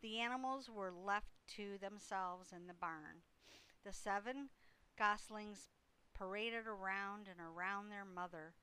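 usual speaking voice

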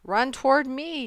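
high, urgent gander yell. A narrator's reading. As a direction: call someone over urgently. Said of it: "yelled the gander".

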